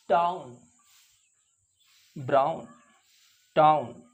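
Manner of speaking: टाउन and ब्राउन with a gliding ow; ow sound. Shown as a demonstration. Teacher showing the ow vowel to students. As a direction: glide from a to o in टाउन. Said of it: "आओ की आवाज़".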